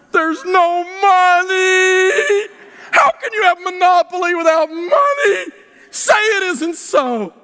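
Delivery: hammed-up crying shout, very high and slow; mock wailing. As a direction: wail it like a heartbroken child. Mock lament over cashless Monopoly.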